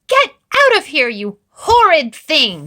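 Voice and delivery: shrill high furious shriek; furious cry. A narrator's reading. Granny furious at the wolf, high loud shriek. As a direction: scream her out in fury. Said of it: "she cried".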